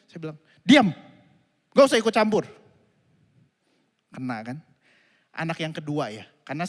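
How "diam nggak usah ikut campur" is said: put-on loud angry snap at a child; furious loud retold outburst; angry shout. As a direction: bark it to shut him up. Father snaps at child.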